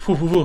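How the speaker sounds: whoo-whoo of something flying through the air; mimed whooshing. Retold or imitated. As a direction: imitate a flung object whooshing. Imitates the hammer thrown through air.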